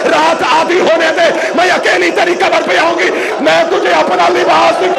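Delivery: impassioned majlis climax, rising toward a wail; rising lament preaching. Acted.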